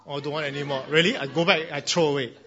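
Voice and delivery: mock threat, teasing the child; playful, put-on warning; joking threat. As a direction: pretend you'll really throw it away. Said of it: "Okay, joking, joking".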